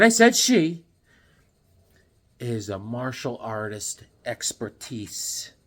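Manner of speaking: ordinary delivery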